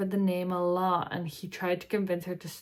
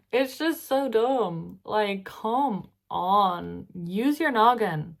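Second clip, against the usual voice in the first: slow, loud, drawn-out exasperation; exasperated; 3 semitones higher, 6 dB louder, slower than usual. Real, not acted. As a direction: spell it out like talking to a fool. Exasperated rant at sister-in-law.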